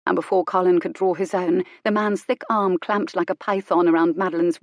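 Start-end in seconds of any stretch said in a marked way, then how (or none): none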